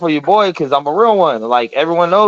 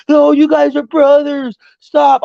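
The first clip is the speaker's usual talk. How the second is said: high, drawn-out mock plea; slow, high-pitched acted alarm; imitated pleading bystanders; 11 semitones higher, 4 dB louder, slower than usual. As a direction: cry out to break up a fight, put-on high voice. Bystanders jump in, retold.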